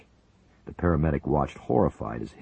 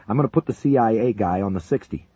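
loud, higher call; shouting; 7 semitones higher, 6 dB louder than usual. A narrator's reading. Paramedic telling the major his plan, loud. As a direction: announce the plan over the noise.

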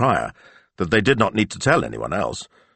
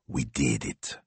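quiet; 7 semitones lower, 9 dB quieter, slower than usual. Acted. Quiet triumphant aside.

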